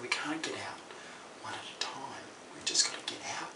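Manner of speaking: soft, breathy urgent whisper. Retold or imitated. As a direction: keep it to a whisper, scared. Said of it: "I was whispering this".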